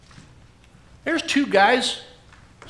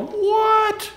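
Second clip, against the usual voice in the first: high startled what; surprised what; 12 semitones higher than usual. Retold or imitated. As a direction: jump up in sudden surprise. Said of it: "He's going".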